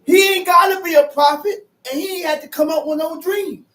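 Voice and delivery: rising preacher shout; preaching. Real, not acted. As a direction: preach it with force.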